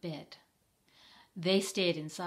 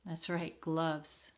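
usual speaking voice

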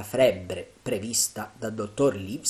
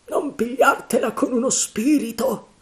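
high, loud rebuke of a fellow sailor; frightened pirate snapping at John; sailor Mary; 13 semitones higher, 6 dB louder than usual. Acted. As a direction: tell John to stop, loudly. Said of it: "disse Mary".

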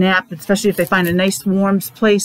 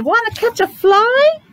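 much higher squawky storybook rooster; 12 semitones higher than usual. A narrator's reading. Reading to a child, the rooster asks in a high voice. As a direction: ask it like a perky rooster. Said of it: "said the rooster".

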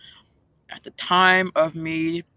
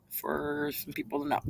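ordinary talk, nothing marked

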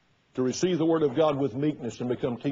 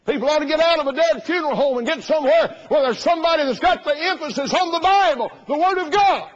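high, loud fiery preaching; full-force preaching; 12 semitones higher, 8 dB louder than usual. Acted. Preacher urging people out of dead churches. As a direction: hammer it home to the congregation.

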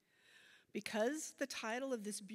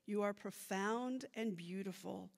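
measured, louder poem recital; poetry reading; 5 dB louder than usual. Acted. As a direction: read it like a poem onstage. Reads her bilingual poem aloud.

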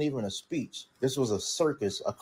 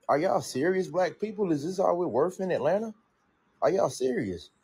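higher-pitched, indignant questioning; incredulous; 7 semitones higher than usual. Real, not acted. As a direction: challenge them in a raised pitch, truly incredulous. Indignant challenge to the Atlanta crowd.

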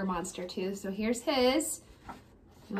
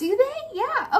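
excited; 13 semitones higher, 6 dB louder than usual. Real, not acted. Eager chat with the kids.

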